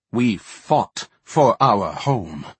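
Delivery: slow, weighty defiance; slow defiant. Acted. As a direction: lean on each word proudly. Varden defends highborn.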